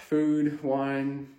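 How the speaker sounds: usual speaking voice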